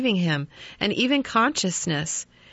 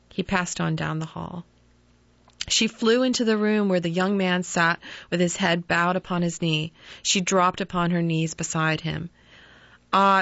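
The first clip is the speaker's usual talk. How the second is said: usual speaking voice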